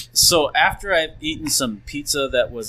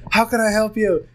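higher, out-of-breath eager employee; panting drive-through worker; 7 semitones higher than usual. Retold or imitated. Sprinting worker greets a customer, breathless and eager. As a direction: chase the car and gasp the greeting. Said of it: "And he's, like, panting".

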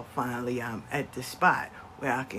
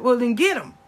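higher and louder, tossing it off; loud dare; 7 semitones higher, 10 dB louder than usual. Retold or imitated. Mother telling aunt, loud and higher. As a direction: challenge the aunt to grab him. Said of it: "She said".

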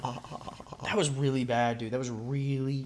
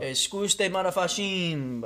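put-on Italian accent, pitched up; higher mock-Italian lilt; 6 semitones higher, much slower than usual. Player giving an accent clue to a guesser, Italian accent.

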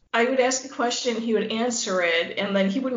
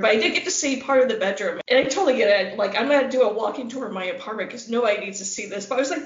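ordinary delivery

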